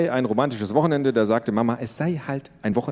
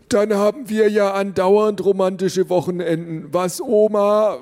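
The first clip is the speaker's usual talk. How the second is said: put-on high, loud, slow cranky old man voice; grandpa; 11 semitones higher, 4 dB louder than usual. Grandpa joking to grandma, high old man voice. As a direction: play a teasing old grandpa. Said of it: "fragte der Opa".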